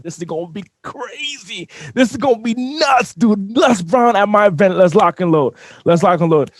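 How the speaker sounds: high, loud excited rush; psyching himself up, loud and high; hyped-up inner voice. Retold or imitated. Telling himself about landing the top speaker, pumped. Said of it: "I'm like".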